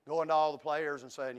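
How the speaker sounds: ordinary talk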